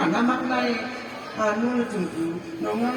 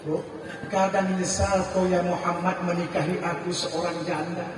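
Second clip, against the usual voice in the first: softer, sad wife asking forgiveness; sorrowful apology; 5 semitones lower, 8 dB quieter than usual. Acted. Wife apologising to her husband for being a widow, softly sad. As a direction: say it like a wife ashamed, quietly.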